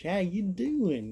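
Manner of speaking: slow Southern drawl on how you doing; Southern accent demo. Shown as a demonstration. Vlogger showing off his father-in-law's Southern voice, slow drawl. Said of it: "talking like this".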